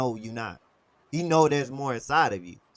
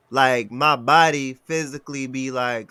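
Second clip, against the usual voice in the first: normal talking voice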